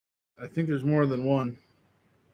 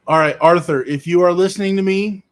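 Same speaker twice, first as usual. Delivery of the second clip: louder, raised voice to get attention; calling out; 8 dB louder than usual. Real, not acted. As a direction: project it across the room to reach him. Calling out to viewer Arthur.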